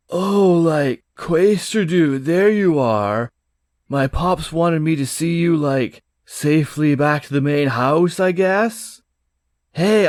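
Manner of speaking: a dopey, laid-back prince; surfer-dude prince. Acted.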